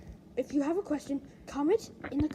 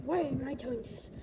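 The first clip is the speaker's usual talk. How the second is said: ordinary talk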